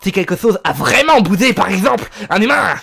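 hammed-up lisp with bursts of loudness; lisping dog boss. Dog boss warns any human who moves, lisping threat. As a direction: play a menacing little boss with a lisp. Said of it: "j'adore lui faire genre qu'il a un feu sur la langue".

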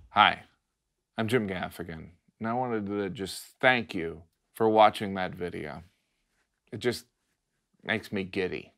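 soft, mock-pitiful appeal; gently guilt them into subscribing; outro plea. Comic asking viewers to subscribe, soft and mock-pitiful.

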